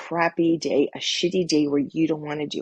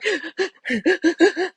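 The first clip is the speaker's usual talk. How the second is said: imitating a high, sharp sob caught in the throat; sobbing gasp; 12 semitones higher, 4 dB louder than usual. Heartbroken girl on the treadmill, mock sobbing gasp. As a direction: gasp in like a crying teen mid-sob.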